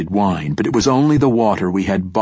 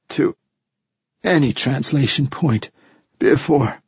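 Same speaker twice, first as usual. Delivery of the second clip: strained, broken dying man; lightly voiced dying captain; 6 semitones higher than usual. A narrator's reading. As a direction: push each fragment out in pain. Dying captain gasps out report.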